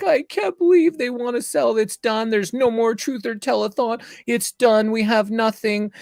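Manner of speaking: high, wailing imitation of a distraught caller; imitating her crying. Organizer phoning the host in tears that the telethon is off, imitated crying. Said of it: "and she's crying".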